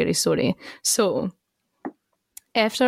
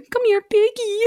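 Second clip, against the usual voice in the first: high, coaxing call to a dog; Mary Queen of Scots calling the dog; coaxing ghost. Pretend. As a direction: sweet-talk the dog like a playful ghost. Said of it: "Maybe she was like".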